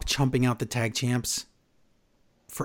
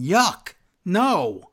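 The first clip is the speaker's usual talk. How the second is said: ordinary delivery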